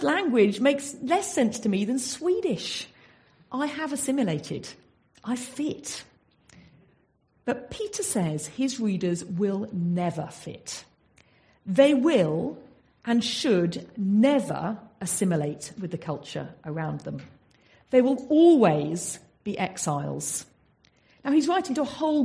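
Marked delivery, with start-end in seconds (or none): none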